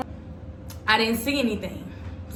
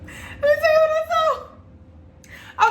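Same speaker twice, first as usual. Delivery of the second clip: very high, drawn-out repeat of the reveal; dramatic squeal; 4 dB louder, slower than usual. Real, not acted. Vlogger repeats her teaser to viewers in a high, dramatic squeal.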